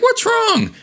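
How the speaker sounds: very high, cartoonish query; acted puzzled question. Eve and Ann asking Chrissy and Kevin, high-pitched question. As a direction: ask it in a squeaky high voice. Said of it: "they're like".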